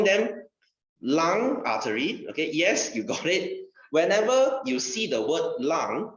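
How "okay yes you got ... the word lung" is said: ordinary talk, nothing marked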